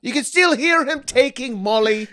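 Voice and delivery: mock-solemn British tale-telling; British storyteller. Comic narrating the Coachella ghost, mock British accent. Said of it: "the person British".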